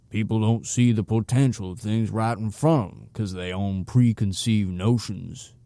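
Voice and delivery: acento sureño áspero, final lento; raspy southern twang. Acted. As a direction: sermonea despacio con deje sureño. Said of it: "in his raspy southern twang".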